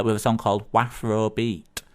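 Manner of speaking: normal talking voice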